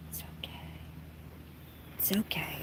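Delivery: soft unvoiced soothing whisper; whispering comfort to the bird. Real, not acted. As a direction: hush the bird gently.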